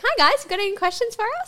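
high, quick guide patter; chirpy mock tour guide. Guide asking a tour group for questions, high and quick. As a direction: pitch it up and rattle it off. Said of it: "Be like".

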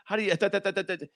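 high, soft rattle of syllables; mock fast talk. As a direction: imitate a rapid foreign talker in nonsense syllables. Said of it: "You guys fucking talk fast".

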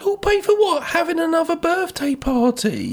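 high incredulous squeal; mock incredulous. Real, not acted. Co-host mocking the mother's threat, high and incredulous. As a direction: sound outraged for comic effect. Mocking disbelief, pitch leap.

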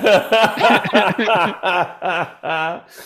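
long, loud, high laugh; hearty laughter. Real, not acted. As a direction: burst out in a long run of laughs. Amused at lipstick and heels line.

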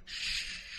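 soft unvoiced shush; whispered shush. A narrator's reading. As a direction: hush her gently with a whisper.

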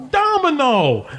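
high, drawn-out hail; delighted greeting. Acted. Sly spotting Domino on the dock, delighted. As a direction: call the name out high with glee.

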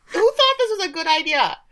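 high-pitched exasperated question; comic high-voiced complaint; mock incredulous. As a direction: squeak it out in mock disbelief. Mocking the scene's silly staging.

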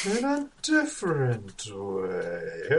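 slow, eerie announcer; spooky host voice. Acted. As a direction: draw it out like a haunted host. Spooky podcast intro.

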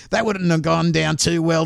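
ordinary delivery

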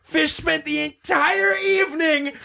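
high, slow, yelling in mock outrage; indignant shout. Real, not acted. Yelling at her friend for not helping that night, high and slow. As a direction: drag it out in a shrill, laughing yell.

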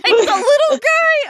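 high squeal of laughter; laughing. Real, not acted.

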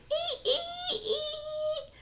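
very high, thin squeals; imitated animal screech. Podcaster imitating the noises they heard, high screeching. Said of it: "It was literally screeching".

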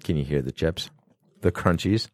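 soft, low-voiced aside; hushed. Real, not acted. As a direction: whisper it near the mic. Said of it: "having snacks in the studio".

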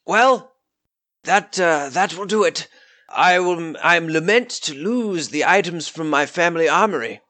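grand generous offer with a smile. Acted.

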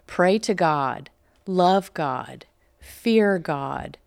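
put-on slow, measured reading of a children's lesson; slow recitation. Host reading the primer's lesson to viewers, slow and deliberate. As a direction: spell each command out like a schoolroom drill.

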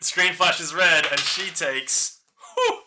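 slow, drawn-out damage narration; dramatic narration. Acted. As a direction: savour the punishment slowly.

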